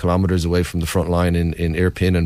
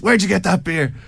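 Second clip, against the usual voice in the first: high-pitched imitated holler; mock shouted question, pitched well up; retold shout; 10 semitones higher than usual. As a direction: call the question out like the Irishman hailing you. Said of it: "an Irish accent shout at me".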